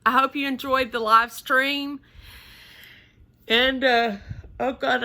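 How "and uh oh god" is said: talking through a yawn; words stretched by a yawn. Real, not acted. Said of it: "I don't know why I'm yawning".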